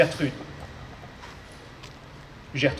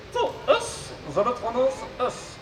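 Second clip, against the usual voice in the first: high robot voice with garbled consonants; 5 semitones higher than usual. Acted. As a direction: mangle the consonants like a glitchy robot. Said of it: "souffre d'une pathologie vocale".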